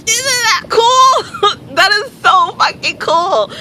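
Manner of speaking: thrilled laughing outburst; excited shriek. Real, not acted. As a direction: squeal with delight. Excited about friend's gods.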